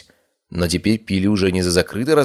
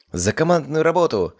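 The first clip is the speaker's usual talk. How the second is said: high, bright raised toast; cheerful toast; 10 semitones higher than usual. Acted. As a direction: cheer it for the team. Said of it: "добавила Мари".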